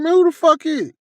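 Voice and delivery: very high, annoyed holler; mock outraged shout at the knocking; retold irritated call-out. Woken by banging, calls out.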